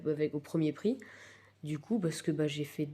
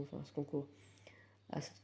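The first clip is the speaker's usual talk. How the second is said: whispering; 10 dB quieter than usual. Real, not acted. Whispered trailing words.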